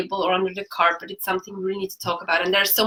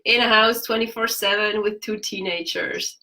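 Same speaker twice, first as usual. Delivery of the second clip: ordinary talk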